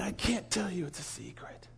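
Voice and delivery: coy, dropping almost to nothing on the last words; secretive. Retold or imitated. Preacher teasing the sheikh with a secret, secretive and hushed. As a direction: trail into a hushed whisper.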